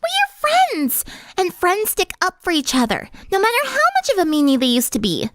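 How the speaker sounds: very high, quick, chirpy; bubbly party girl. Acted. Pinkie cheerfully tells Sunset friends stick up, chirpy.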